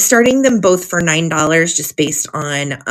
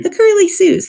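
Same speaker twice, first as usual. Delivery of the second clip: much higher, delighted "The Curly Sues"; excited echo; 14 semitones higher than usual. Real, not acted. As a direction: sing out "The Curly Sues" with glee. Repeats the name after listener.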